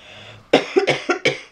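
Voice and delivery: a short dry cough. Real, not acted. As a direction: cough once into the mic. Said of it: "Excuse me, coughing".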